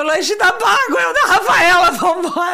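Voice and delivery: loud, high, tearful laughing call; re-enacted helpless laughter; crying with laughter. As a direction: shout it through a laughing fit. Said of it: "Eu chorava de rir".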